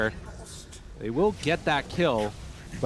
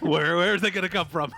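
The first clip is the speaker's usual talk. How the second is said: higher, lightly panicked imitation; mock panic; 4 semitones higher, 4 dB louder than usual. Caster imagines a player fearing an ambush, mock panic. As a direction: act out a panicking player searching around. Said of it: "He's panicking".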